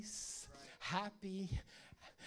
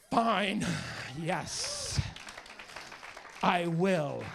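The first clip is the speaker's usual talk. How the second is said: grudging wife; 5 dB louder, much slower than usual. Retold or imitated. Wife's grudging reply, retold.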